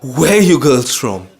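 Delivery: retold blurt, a bit loud; nervous outburst. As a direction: blurt out the question, breaking a long silence. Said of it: "finally I break out".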